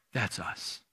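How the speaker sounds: softly, as a quiet revelation; hushed conclusion. Real, not acted. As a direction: drop it low and intimate. Quiet climax of sermon point.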